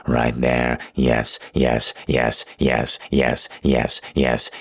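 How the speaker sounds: low, intense, repetitive urging; urgent urging. Acted. Lover urges partner on, low and urgent.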